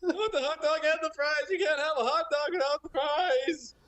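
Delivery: high-pitched sitcom whine over fries; Seinfeld bit. Pretend. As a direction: whine about the hot dog like a sitcom comic. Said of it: "now do it as Jerry Seinfeld".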